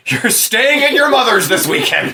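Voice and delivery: high-pitched mock parental scolding; hammed-up father laying down the law; mock exasperated dad. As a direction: scold the kids like a fed-up dad. Mock angry divorced dad, joining in.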